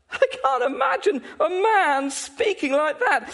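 high-pitched, emphatic amazement; incredulous. Real, not acted. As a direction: exclaim it in ringing disbelief. Preacher marvels, rising to a peak.

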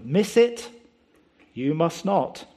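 usual speaking voice

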